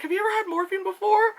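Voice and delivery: high, quick nurse question; lightly voiced brisk nurse. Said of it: "they were like".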